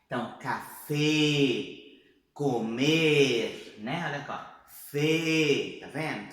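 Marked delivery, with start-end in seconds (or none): 0.4-3.8 CAFÉ and COMER with long closed e; closed vowel. Shown as a demonstration. Teacher modelling Spanish words, closed vowel. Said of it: "Boca fechada". 4.7-5.8 FÉ loud and long with a closed ê sound; closed vowel. Shown as a demonstration. Teacher showing Spanish fe, closed vowel. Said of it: "Tudo fechado".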